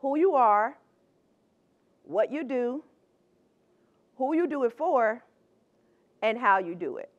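each part set off by long pauses; "Who you are, what you do, who you do it for, and how you do it" with a pause before each item; spaced-out list. Shown as a demonstration. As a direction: tick off the four parts one at a time. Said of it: "I like to break it down this way".

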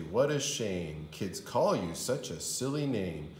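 usual speaking voice

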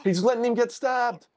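high, outraged ranting; furious disbelief at the screen; angry outburst. Real, not acted. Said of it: "I'm so fucking mad at him".

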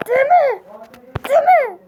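high, loud outburst, climbing each time; angry shouting. Acted. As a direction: yell a repeated order to stop. Refuses the nickname, escalating.

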